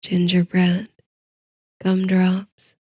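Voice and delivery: plain reading voice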